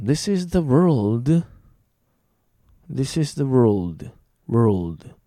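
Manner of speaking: slow model sentence with drawn-out "world"; clear pronunciation demo. Shown as a demonstration. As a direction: stretch "world" with curled r into a clear l. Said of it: "発音の違いをしっかり耳で覚えて".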